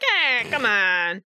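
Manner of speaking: ordinary talk, nothing marked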